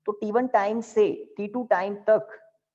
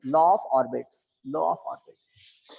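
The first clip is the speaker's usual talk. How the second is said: slow, spaced dictation; dictating; 6 semitones lower, much slower than usual. Shown as a demonstration. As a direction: draw it out for writing. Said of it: "लिखिए inverted comma में". (धीरे-धीरे लिखवाते हुए)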